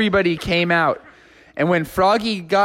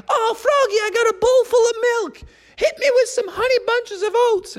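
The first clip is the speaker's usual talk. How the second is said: much higher, whiny cartoon plea; pleading party guy. Guy begging Froggy to fire more cereal, high whiny plea. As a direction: beg like a cartoon kid who needs a refill.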